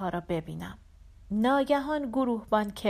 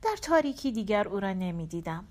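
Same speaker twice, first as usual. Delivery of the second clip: ordinary delivery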